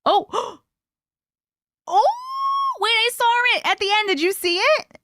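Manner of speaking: high-pitched squealed reaction; excited discovery. Real, not acted. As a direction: burst out high, as if you just spotted it. Spots something in the video.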